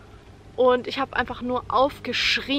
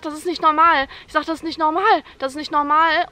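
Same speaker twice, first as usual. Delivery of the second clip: louder, higher mock protest; retold frantic insistence; 3 semitones higher, 6 dB louder than usual. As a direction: re-enact desperate insistence. Retold hysterical protest during labour.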